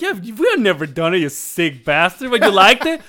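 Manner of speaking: hammed-up shouting friend; mock outrage. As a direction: roar with teasing disgust.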